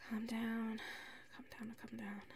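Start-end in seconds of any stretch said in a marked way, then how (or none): 1.3-2.2 very soft whispered self-calming. Acted. Calming herself down in a whisper after seeing her roommate. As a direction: hush yourself, willing the nerves away.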